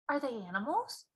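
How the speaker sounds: put-on a puzzled small girl; Sophie's voice. Sophie puzzled by the giant, softly.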